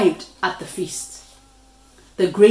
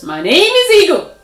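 proud; 9 semitones higher, 7 dB louder, faster than usual. A narrator's reading. Birds introduce themselves.

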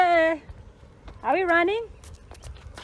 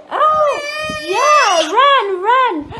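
excited; 8 semitones higher, 5 dB louder, much slower than usual. Pretend. Fleeing the pirate in play.

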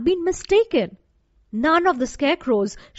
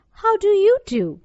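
high, lifted, louder return greeting; polite little girl; 9 semitones higher, 5 dB louder than usual. A narrator's reading. Dorothy returning the scarecrow's greeting, politely and high. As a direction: raise the pitch and politely ask back. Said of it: "replied Dorothy politely".

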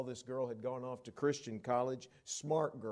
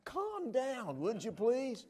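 mock pleading; 11 semitones higher, slower than usual. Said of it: "So I had to say".

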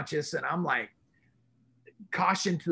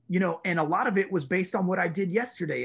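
ordinary talk, nothing marked

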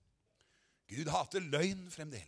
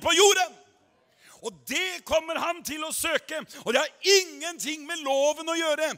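building to a shouted climax; preaching at full force; 6 semitones higher, 8 dB louder than usual. Acted. Preacher rousing the congregation, building to a shout. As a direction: drive it home louder and louder.

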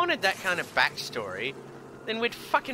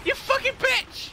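real gamer rage, shrieking high; furious yell; 8 semitones higher than usual. Real, not acted. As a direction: let rip at the enemy that hit you. Raging at enemy in game.

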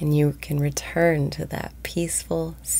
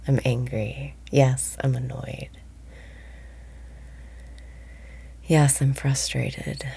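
guided meditation. Acted. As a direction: ease listeners into calm, breath by breath. Leads a daily guided meditation.

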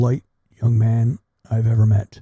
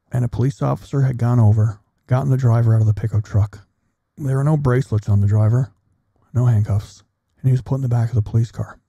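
ordinary talk, nothing marked

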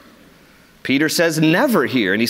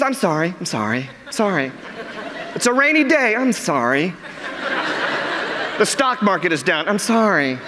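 apologetic ladies; 4 semitones higher than usual. Retold or imitated. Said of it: "You're just".

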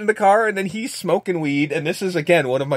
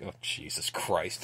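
muttered disgust; 10 semitones lower, 16 dB quieter, slower than usual. Retold or imitated. Cop disgusted by greasy hair.

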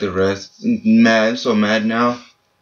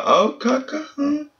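very high squeak of surprise; surprised. Real, not acted. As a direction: gasp it out, then a shocked huh. Surprised at player.

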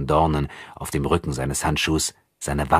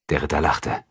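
ordinary delivery

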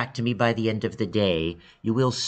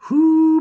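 a loud, very high whoo; excited whoop; 12 dB louder than usual. Real, not acted. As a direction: let out a big happy whoop. Reacting after announcing the team.

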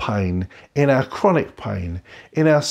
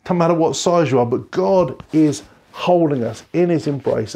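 normal talking voice

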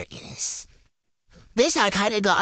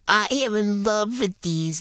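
usual speaking voice

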